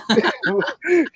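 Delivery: sudden high laugh; high burst of laughter. Real, not acted. Laughing at her own hooking joke. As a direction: cackle at your own line.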